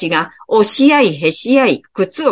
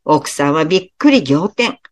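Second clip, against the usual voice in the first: ordinary delivery, nothing marked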